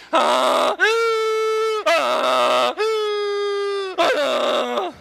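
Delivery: loud wheezing harmonica honks; mock donkey harmonica; asthmatic donkey. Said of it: "like some asthmatic donkey".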